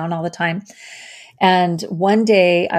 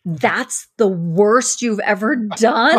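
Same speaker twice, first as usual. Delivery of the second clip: shocked disbelief; 3 semitones higher than usual. Retold or imitated. Husband's confession, her heart stopped.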